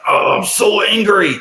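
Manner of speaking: high, loud, slow whiny fuming; mock angry teen. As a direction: imitate the son griping that he's angry. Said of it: "when he gets angry and he's like".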